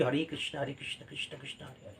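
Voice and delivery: softly murmured Hare Krishna chant, fast; quiet rapid murmur of the mantra; murmured japa. Shown as a demonstration. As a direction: chant the mantra under your breath as if telling beads. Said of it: "আমি যখন মনে মনে জপছি".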